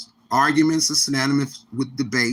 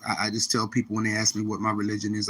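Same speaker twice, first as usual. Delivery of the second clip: usual speaking voice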